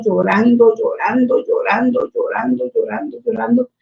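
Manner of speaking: soft, trailing repeats; hushed recollection of long weeping; fading repetition. Retold or imitated. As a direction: let each repeat sink away quietly. Retelling prolonged weeping on her knees.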